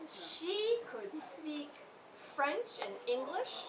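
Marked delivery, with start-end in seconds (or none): none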